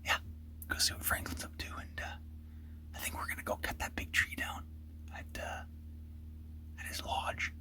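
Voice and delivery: hushed whisper so game can't hear. Real, not acted. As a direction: keep it to a whisper in the blind. Hunter whispering in blind.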